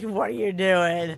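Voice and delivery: slow, sleepy, annoyed; drowsy grumble. Retold or imitated. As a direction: mumble it like you're half asleep. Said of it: "You're like".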